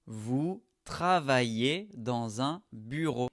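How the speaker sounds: slow model sentence. Shown as a demonstration. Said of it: "le E et le Z se prononcent et".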